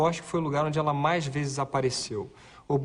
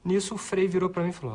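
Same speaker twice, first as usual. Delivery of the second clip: ordinary delivery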